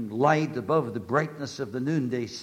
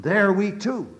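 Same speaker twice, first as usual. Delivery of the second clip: rising preaching; 3 semitones higher, 9 dB louder than usual. Acted. Sermon climax.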